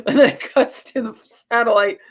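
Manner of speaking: ordinary talk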